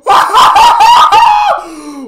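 long bursting laugh; high laughing fit. Real, not acted. Laughs at game chaos.

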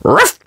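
short, high "ruff"; pup's bark. Acted. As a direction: bark once like an eager puppy. Pup barks before acting.

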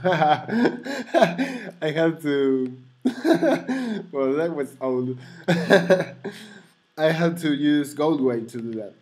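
real laughter breaking up the words; laughing. Real, not acted. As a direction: chuckle helplessly while admitting it. Laughing at his outdated workaround.

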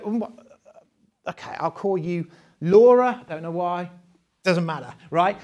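ordinary talk, nothing marked

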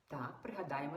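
usual speaking voice

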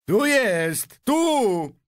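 high, loud answering cry; shouting back. A narrator's reading. Another onlooker points out where he is, shouting back. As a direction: call back excitedly across the crowd. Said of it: "odkrzyknął ktoś inny".

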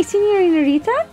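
usual speaking voice

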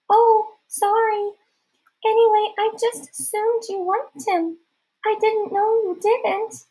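very high, slow falsetto; a boy apologizing in a fake girl voice; drawn-out, dainty put-on squeak; disguised girl voice. A narrator's reading.

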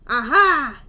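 loud, triumphant "aha"; a sudden loud exclamation; exclaimed discovery. A narrator's reading. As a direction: burst out as if catching someone out. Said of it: "exclaimed the foreman".

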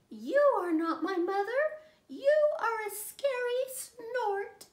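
mock fright. Baby bird frightened by the snort, high mock-scared squeal.

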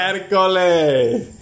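very slow, stretched-out commentator cry; drawn-out exclamation. Real, not acted. Host cheering Juanfe in the race, drawn-out exclamation. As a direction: hold the vowels long in amazement.

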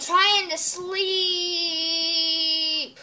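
long, drawn-out whine; mock sleepy complaint. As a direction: drag it out loudly, pestered out of sleep. Disturbed sleeper in roleplay.

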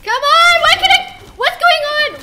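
loud high frustrated shouting; furious yelling. Real, not acted. Gamer yelling at her companion Cal in frustration. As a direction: yell it at the game in frustration.

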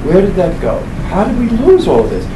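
usual speaking voice

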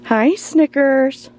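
sweet high cat-greeting voice; pet talk. Real, not acted. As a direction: coo a greeting to the kitty.

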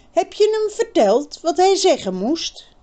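put-on girl's voice, impatient. A narrator's reading. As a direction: push him to spill it. Birgit presses.

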